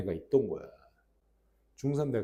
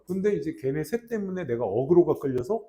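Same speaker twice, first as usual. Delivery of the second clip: ordinary talk, nothing marked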